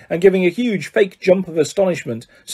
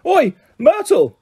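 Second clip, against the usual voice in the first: high, drawn-out mock bellow; 10 semitones higher, slower than usual. A narrator's reading. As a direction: call out slowly at a high pitch, half-bellowed. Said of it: "took a deep breath and bellowed".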